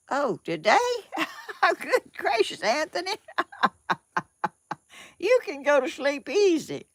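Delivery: high, laughing and delighted, words squeezed out between laughs; a real burst of laughter at a funny comment. Real, not acted. Laughing high while answering a viewer's comment.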